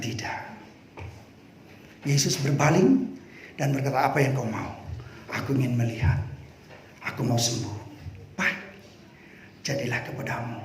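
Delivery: low, soft gentle reply; Jesus answering the blind man gently; calm gentle Jesus. Acted. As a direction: speak quietly and kindly.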